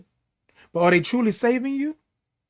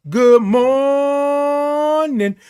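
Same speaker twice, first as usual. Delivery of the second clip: good morning stretched very slowly and higher; drawn-out greeting; 6 semitones higher, much slower than usual. Real, not acted. Host greeting Le Crochet with a long, stretched-out good morning. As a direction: sing out a long, lingering hello.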